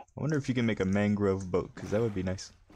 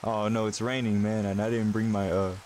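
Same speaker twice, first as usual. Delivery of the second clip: normal talking voice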